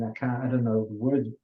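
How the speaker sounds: normal talking voice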